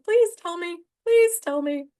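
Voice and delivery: high, loud, begging repeat; anxious pleading. Real, not acted. Vlogger worried about getting kicked off, high loud pleading. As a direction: plead that the stream isn't dropping. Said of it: "I'm like".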